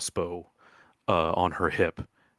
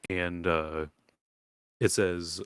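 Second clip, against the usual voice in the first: normal talking voice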